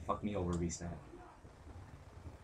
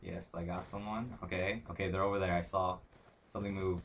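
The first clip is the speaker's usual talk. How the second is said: ordinary talk, nothing marked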